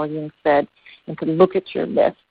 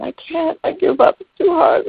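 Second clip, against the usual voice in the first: high, louder despairing wail; a small voice crying that it's hopeless; giving-up frog; 13 semitones higher, 4 dB louder than usual. Acted. First frog quitting in the milk pail, high, louder and despairing. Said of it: "the other one".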